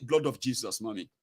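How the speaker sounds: son's response. Retold or imitated. Son answering mommy's prayer, low and subdued. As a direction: imitate a son meekly joining in, low. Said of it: "Your son will be saying".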